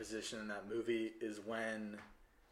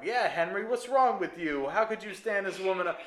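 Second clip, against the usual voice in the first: high, loud ribbing voice; teasing friends; 7 semitones higher, 6 dB louder than usual. Retold or imitated. Henry's friends tease him, high and loud. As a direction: rib him like his buddies. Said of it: "all his friends are around like".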